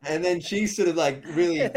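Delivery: loud words through laughter; real laughter in the voice; laughing while talking. Real, not acted. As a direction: keep laughing as you tell it.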